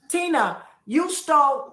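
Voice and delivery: high, forceful direct accusation; angry confrontation. Real, not acted. Woman addressing her absent sister about theft, angrily confronting. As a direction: confront her head-on, indignant.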